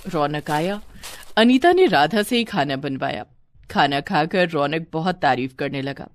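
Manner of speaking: ordinary delivery, nothing marked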